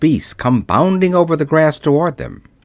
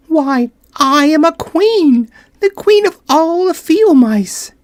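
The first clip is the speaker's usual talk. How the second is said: high, tiny voice with royal pride; a little queen drawing herself up; proud mouse queen; 3 dB louder than usual. Acted.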